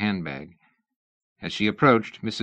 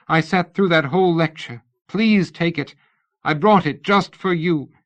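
pleading; 7 semitones higher, 5 dB louder than usual. A narrator's reading. Missus Tournachon begging Lady Ada, high loud pleading. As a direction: beg her to accept the gift.

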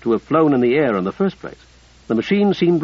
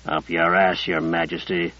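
through gritted teeth; 8 semitones lower, 5 dB quieter than usual. A narrator's reading. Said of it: "through gritted teeth".

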